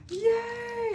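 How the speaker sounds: high, squealed yay; squealing over pink glitter ones; excited yay. Retold or imitated. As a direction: squeal with joy. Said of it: "I was like".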